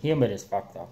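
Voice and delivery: talking with dip in lip; chewing tobacco. Real, not acted. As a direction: speak around a lip of dip. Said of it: "we're gonna pack the lip".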